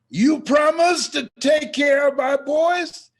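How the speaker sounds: high, loud, breaking plea through tears; desperate tearful cry. Retold or imitated. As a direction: beg her through tears to keep the promise. Birth mother pleading in tears.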